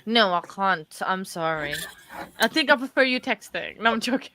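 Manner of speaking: usual speaking voice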